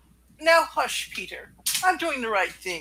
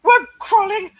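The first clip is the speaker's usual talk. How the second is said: high, loud squealing aunt rant; J J's rant in Aunt May's voice; 11 semitones higher, 10 dB louder, slower than usual. Acted. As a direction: rant like a shrieking old aunt. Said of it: "J J's voice done in Aunt May's voice".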